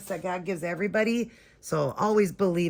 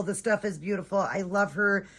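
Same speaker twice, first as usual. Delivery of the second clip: usual speaking voice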